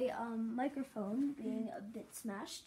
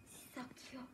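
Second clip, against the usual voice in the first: whisper; 9 dB quieter, much faster than usual. Acted. Whispering in psycho role.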